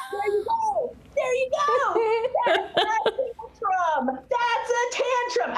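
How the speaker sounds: high, slow, laughing squeals; delighted laughing at Opie's fit. Real, not acted.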